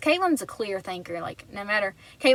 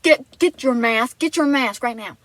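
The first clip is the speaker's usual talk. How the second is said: louder, insistent order; urgent command; 2 semitones higher, 7 dB louder than usual. Retold or imitated. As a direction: Caitlin urgently ordering Abigail to grab the mask, louder. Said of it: "And she said".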